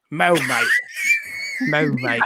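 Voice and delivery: loud Aussie "Mo, mate"; Australian cow. Pretend. Australian moo for the podcast, shouted.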